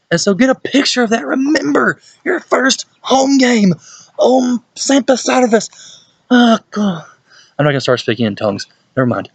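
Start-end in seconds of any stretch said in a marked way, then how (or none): 0.1-7.0 very high, drawn-out excited cry; slow, squealing hype; over-the-top excitement. Real, not acted. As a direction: stretch it out high, carried away with excitement. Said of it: "I'm scaring my dog right now".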